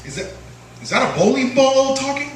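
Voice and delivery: put-on high teasing kid voice; mocking cousin. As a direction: jeer at the fat baby. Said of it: "my cousins would come in and say".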